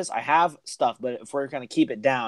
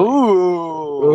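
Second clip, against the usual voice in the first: teasing ooh; 3 semitones higher, 7 dB louder than usual. Acted. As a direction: tease them with an ooh. Teasing ooh at collab news.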